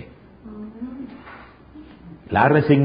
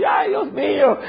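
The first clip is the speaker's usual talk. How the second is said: high mock wail for the dead; hired mourner wailing; 14 semitones higher than usual. Pastor imitating paid mourners at a Colombian funeral, mock wailing. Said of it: "iban llorando".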